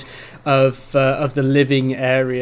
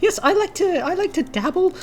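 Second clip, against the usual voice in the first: put-on much higher, gushing girly voice; high girly half-orc; 14 semitones higher than usual. Half-orc blacksmith gushes at the bard in a high girly voice. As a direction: gush like an excited schoolgirl. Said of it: "she's like".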